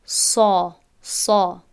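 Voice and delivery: heavy emphatic سا; full-mouthed سا; heavy sad. Shown as a demonstration. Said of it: "این را ما باید تلفظ کنیم".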